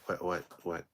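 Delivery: ordinary talk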